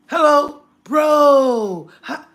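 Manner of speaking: loud, high, eager hello; excited greeting. Real, not acted. Greeting a viewer on her live stream, loud and high. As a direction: call out hello, thrilled to see him.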